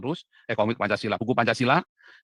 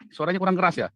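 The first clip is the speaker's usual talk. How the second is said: louder on purpose; 7 semitones higher, 7 dB louder than usual. Real, not acted. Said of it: "suaranya bisik-bisik kayak selingkuhan".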